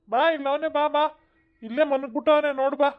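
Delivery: high eager call to the others; excited call. Acted. Uncle calling the others to the found boy, high and eager. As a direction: come quick, he's sleeping here.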